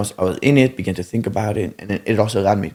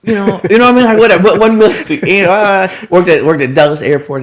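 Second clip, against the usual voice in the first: laughing; 8 semitones higher, 7 dB louder than usual. Real, not acted. Laughing about odd jobs.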